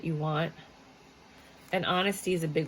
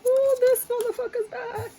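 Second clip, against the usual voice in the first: much higher, slow, loud mock outrage; high surprised squeal; 6 dB louder, slower than usual. Real, not acted. Reader squeals as the Emperor card comes back. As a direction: squeal at an unwelcome return.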